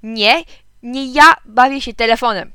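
loud, pointed comeback; indignant retort. A narrator's reading. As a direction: mum hitting back in a quarrel. Mother quarrelling with father. (głośna, oburzona riposta)

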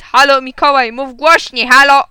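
loud, high demand down the phone; shout. A narrator's reading. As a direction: yell as if you can't hear the other end. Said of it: "krzyknął Alcest". (krzyk do słuchawki)

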